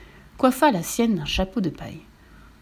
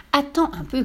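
higher-pitched call to wait; 6 semitones higher than usual. Acted. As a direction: call after someone, pitched up. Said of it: "cria la vache".

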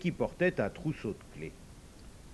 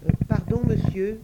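high, timid little devil voice; a small creature asking shyly; lightly voiced small devil; 9 semitones higher, 8 dB louder than usual.

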